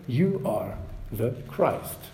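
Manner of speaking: soft, slow and deliberate; measured answer. Retold or imitated. Peter answering Jesus' question, soft and measured. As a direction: say it softly, letting each word stand. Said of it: "to-the-point answer".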